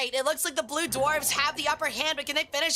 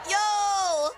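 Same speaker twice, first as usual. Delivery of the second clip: shout; 5 semitones higher, 3 dB louder than usual. Real, not acted. Streamer greets incoming raid.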